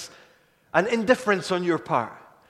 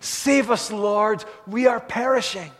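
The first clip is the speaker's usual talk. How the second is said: higher, louder plea for rescue; cry of desperation; 3 semitones higher, 4 dB louder than usual. Retold or imitated. Disciples begging the Lord to save them, desperate cry. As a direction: shout it like the boat is sinking. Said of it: "they cry out in desperation".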